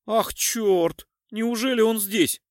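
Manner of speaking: high, loud shocked exclamation; gasping dismay. A narrator's reading. Krichko hearing the bandit is here, gasping in shock. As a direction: gasp it out in alarm. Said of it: "ахнул Кричко".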